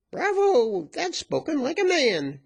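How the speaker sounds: very high, loud cheer; virago's cry. A narrator's reading. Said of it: "cried the virago".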